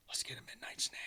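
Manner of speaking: hushed conspiratorial whisper; a playful secret whisper; retold whisper. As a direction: whisper it like sneaking past sleeping parents. Sneaking a midnight snack with daughter.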